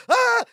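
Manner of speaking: high mock shriek of shock; imitated scream. Co-host retelling Larry seeing the knife, mock scream. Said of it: "looks down and Fred goes".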